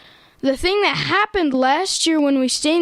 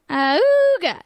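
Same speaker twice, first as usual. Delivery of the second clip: car horn sound; 5 semitones higher than usual. Shown as a demonstration. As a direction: honk like an old jalopy. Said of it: "It goes a little something like this".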